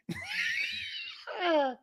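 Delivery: high, drawn-out wheeze of laughter; real wheezing laugh. Real, not acted. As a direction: crack up helplessly at your own joke.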